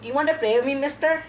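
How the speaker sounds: put-on high, childlike pleading question; imitated kindergartner. Kindergartner asks a stranger to play, high and childlike. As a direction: pipe up like a little kid asking. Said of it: "one of those kindergartens where they ask".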